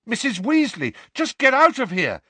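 high, loud urgent shout; the boggart as Harry shouts at Mrs Weasley. A narrator's reading. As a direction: yell for her to get out. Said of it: "shouted Harry".

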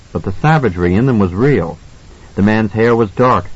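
ordinary delivery

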